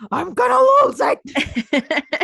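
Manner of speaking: high, loud and slow, ready to explode; mad cartoon guy. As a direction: growl it like the little red rage guy about to blow. Voicing the angry cartoon character.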